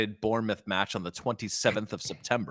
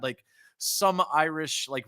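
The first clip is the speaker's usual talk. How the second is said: normal talking voice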